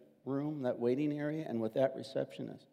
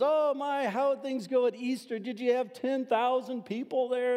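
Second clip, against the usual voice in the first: much higher, gushing questions; excited dentist; 10 semitones higher than usual. Retold or imitated. Dentist greeting her pastor patient after Easter, excited and high-pitched.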